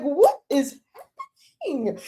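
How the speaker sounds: high-pitched fake-crying wail; mock tearful bewilderment. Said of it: "I was crying".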